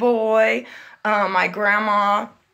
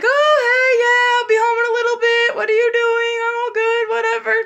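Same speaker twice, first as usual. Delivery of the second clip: high, bright fake-casual stepmom voice; stepmom's casual voice; imitating stepmom; 12 semitones higher, 5 dB louder than usual. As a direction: mimic her breezy phone chatter, pitched way up. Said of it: "listening to her talking like".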